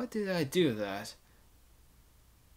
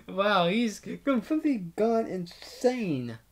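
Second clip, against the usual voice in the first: laughing; 8 semitones higher, 3 dB louder than usual. Real, not acted. Player reacts to repeated lines.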